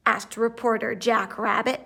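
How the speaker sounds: ordinary delivery, nothing marked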